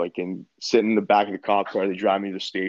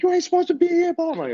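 much higher, louder mock shriek; yelling desk lady; 4 dB louder, faster than usual. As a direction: mimic the front-desk lady scolding. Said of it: "she starts yelling".

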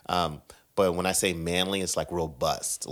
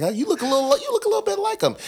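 high teasing sing-song voice; mock stranger voice; 5 dB louder, faster than usual. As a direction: play a stranger spotting a lookalike. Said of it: "He's like".

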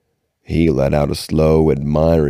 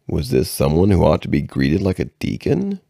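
plain reading voice

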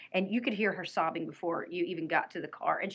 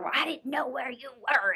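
higher-pitched retold retort; mock defensive comeback; biting back; 5 semitones higher than usual. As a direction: imitate a mother snapping back. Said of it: "is biting back at her".

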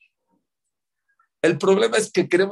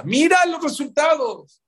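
emphatic call; 12 semitones higher, 6 dB louder, slower than usual. Real, not acted. Urging the audience to look.

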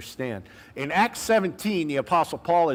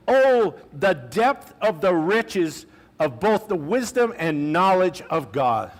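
solemn reading; 3 semitones higher, 3 dB louder than usual. Acted. Preacher reads Paul's praise aloud.

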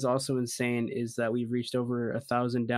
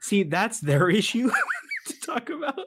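laughing; 8 semitones higher, 4 dB louder than usual. Real, not acted. Storyteller passes the question to the Wendy's staff, laughing. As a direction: squeeze the answer out between laughs. Still cracking up while answering.

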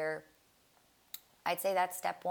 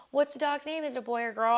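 eager question; 7 semitones higher, 6 dB louder than usual. Retold or imitated. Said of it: "I was like".

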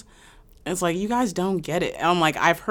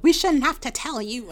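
higher, dismissive mimicry; higher mock dismissive voice; mock snooty voice; 9 semitones higher than usual. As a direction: brush them off like a snob. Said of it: "they're like".

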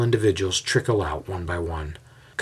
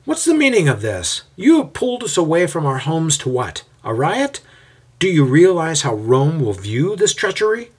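higher, louder angry rebuke; indignant yelling; 8 semitones higher, 5 dB louder than usual. A narrator's reading. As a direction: scold the mob's leader. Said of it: "yells out to the mob's leader".

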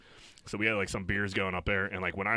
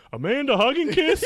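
high, loud shouted question on "Amanda Hug and Kiss?"; yelling it out loud; 10 dB louder than usual. Retold or imitated. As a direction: bellow "Amanda Hug and Kiss?" to the whole room, rising at the end. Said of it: "just yelling it fucking out loud".

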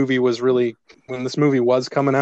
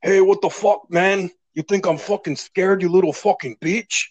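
high-pitched mock tough-guy challenge; George Lopez all serious; 6 semitones higher than usual. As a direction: imitate a comedian acting all serious and threatening. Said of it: "George Lopez just get all serious".

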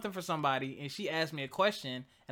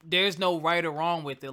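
normal talking voice

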